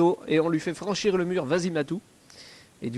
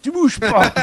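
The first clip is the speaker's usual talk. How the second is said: stern barked order, louder on repeat; guard shouting; 3 semitones higher, 4 dB louder, slower than usual. Pretend.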